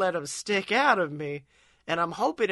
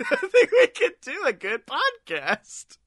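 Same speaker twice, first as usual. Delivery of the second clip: laughing; 11 semitones higher, 3 dB louder than usual. Real, not acted. Laughing through line.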